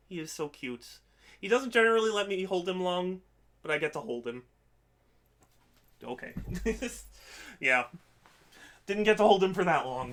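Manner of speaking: ordinary delivery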